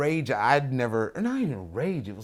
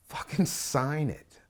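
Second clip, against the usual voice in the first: drawn out slowly, every word pressed; slow insistent demand; 2 semitones higher, much slower than usual. Retold or imitated. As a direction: wear him down word by word. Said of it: "all I said was".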